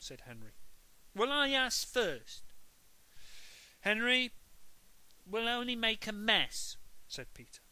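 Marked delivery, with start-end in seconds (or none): 1.1-6.8 high, petulant tattle; whiny little brother. Acted. Peter arguing with Henry over helping. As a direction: whine it like a prissy child.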